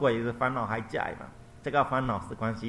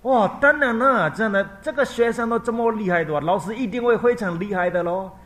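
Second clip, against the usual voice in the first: mother marvelling at the Buddha; awed old mother; 11 semitones higher, 10 dB louder than usual. Acted. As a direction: gush in admiration. Mother praising the teacher.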